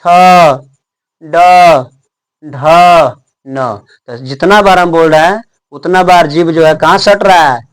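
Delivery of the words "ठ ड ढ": long pauses between letters; teacher slowly sounding out the retroflex letters; retroflex letter demo. Shown as a demonstration. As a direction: say "ठ, ड, ढ" one by one, tongue curled back. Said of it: "जैसे बोला ट".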